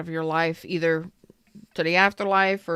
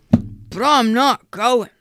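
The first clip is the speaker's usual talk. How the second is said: slow, louder, drawn-out pouty refusal; stubborn refusal; 5 semitones higher, 8 dB louder, much slower than usual. Retold or imitated. Reader mimics a stubborn Cancer refusing, slow pouty. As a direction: dig in your heels and refuse. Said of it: "But you wanna go".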